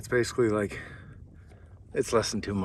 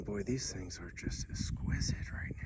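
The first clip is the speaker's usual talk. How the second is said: whispering; 4 semitones lower, 5 dB quieter than usual. Real, not acted. Awed by hot pots in sunlight.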